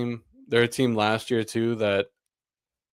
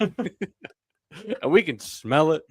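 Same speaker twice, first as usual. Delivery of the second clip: slow, higher, hammed-up predator line; mock menacing; 6 semitones higher, much slower than usual. To his co-host about the Celtics rematch, slow hammed-up menace. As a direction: drag it out like a shark circling. Slow dramatic retake, laughing.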